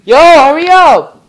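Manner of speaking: impatient call, loud and slow; loud urging. Real, not acted. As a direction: yell at them to hurry. Urging a friend loudly.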